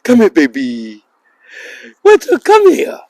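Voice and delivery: high, loud sing-song call; calling the dog. Real, not acted. Owner calling his dog to come, high and loud.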